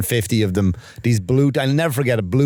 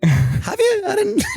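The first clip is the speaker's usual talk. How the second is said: laughing reply; slower than usual. Retold or imitated. Retold reply to the barman.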